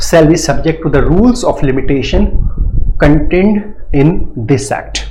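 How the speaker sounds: drawn-out reading of the legal clause, very slow; slow statute reading. Shown as a demonstration. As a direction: dictate the clause slowly to the class. Teacher reads clause again slowly.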